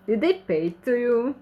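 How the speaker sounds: ordinary delivery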